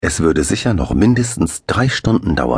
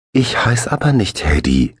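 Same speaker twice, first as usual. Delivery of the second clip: put-on higher child voice, earnest; serious child; 6 semitones higher than usual. A narrator's reading. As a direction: correct him with grave seriousness. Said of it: "sagte er ganz ernst".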